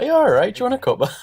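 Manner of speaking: high, loud fussing mum; cooing like a fussing mum. Retold or imitated. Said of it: "a proper mum thing to do".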